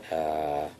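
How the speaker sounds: very soft, low, almost whispered aside; muttered disbelief. A narrator's reading. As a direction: mutter it under your breath as the pigeon. Pigeon scoffs at the duck's question.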